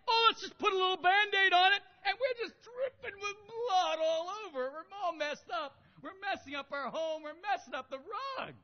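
a hint of a weepy sinner making excuses; whiny self-excuser. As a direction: play the sinner covering up, half-crying. Mocking how we treat sin.

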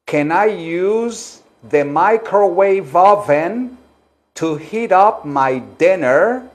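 drawn-out teacher's demonstration; slow model sentence. Shown as a demonstration. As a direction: model it slowly for learners to repeat.

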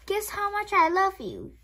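put-on high, childlike little hare. Bunny asking his father at bedtime, high and eager.

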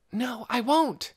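put-on high, hushed excited boy voice; eager young boy. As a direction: tell it eagerly, keeping it hushed. Scargo insisting.

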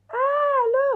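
very high, loud gasp of delight; delighted shriek. Real, not acted. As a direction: squeal with real joy at the gift. Opens box, sees gift.

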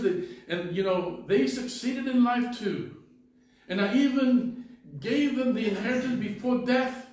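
put-on old man telling the young visitor of his lost sons, loud and bitter; bitter old man's lament. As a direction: pour out a lifetime of bitterness.

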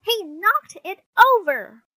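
high, loud accusation; shrieked sibling blame; little sister crying out. Acted. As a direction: tattle at the top of your voice. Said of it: "cried Sister".

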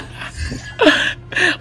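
high-pitched breathless laugh; amused, mostly voiceless giggle. Real, not acted. Laughing during game banter.

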